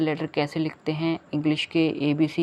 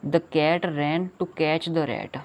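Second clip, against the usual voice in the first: very slow reading; much slower than usual. Shown as a demonstration. Reading poem line to children.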